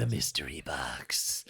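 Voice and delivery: slow, hushed mock-mysterious whisper. Host echoing co-host's mystery box joke, whispered. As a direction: whisper it slowly, savoring the mystery. Echoes phrase in spooky whisper.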